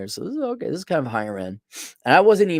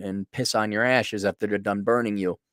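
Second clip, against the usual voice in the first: ordinary delivery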